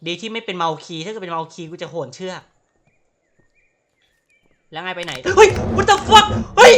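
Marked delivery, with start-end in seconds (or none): 4.7-6.7 much higher and louder, yelling in fright; panicked shout. Real, not acted. Gamer chased by a deer, panicked high shout. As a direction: scream it as something charges you.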